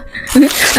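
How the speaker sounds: brief, quiet breathy laugh; soft chuckle. Real, not acted. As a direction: chuckle softly at your own sign-off. Chuckles at own Halloween sign-off. (tawa kecil pelan)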